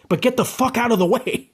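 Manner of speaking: fed up, blurt it out high; angry outburst. Real, not acted. Ranting at pompous musicians, a real angry outburst.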